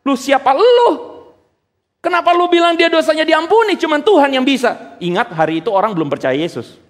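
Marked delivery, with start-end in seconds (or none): 0.1-4.9 angry crowd. Retold or imitated. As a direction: play the outraged crowd shouting at him. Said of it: "Jadi orang-orang marah".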